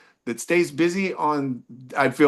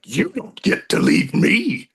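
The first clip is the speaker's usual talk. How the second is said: slow, higher, menacing imitation; scary partner; 5 semitones higher, 3 dB louder, slower than usual. Comic quoting the partner he tried to leave, slow scary voice. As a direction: mimic a frightening ex, stretching the words. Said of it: "her response scared me to death".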